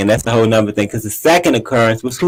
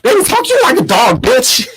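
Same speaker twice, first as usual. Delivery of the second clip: high, hammed-up outburst; squealed mock outrage; Portia imitation. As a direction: mimic an indignant outburst for laughs. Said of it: "Portia said".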